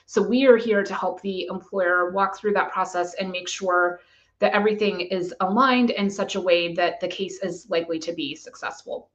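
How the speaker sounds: normal talking voice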